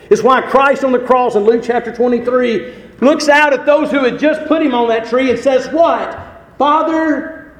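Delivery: loud, high pulpit proclamation; full-force preaching. Real, not acted. Preacher recalling Christ on the cross, full-force preaching. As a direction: build to a ringing, high call on the last word.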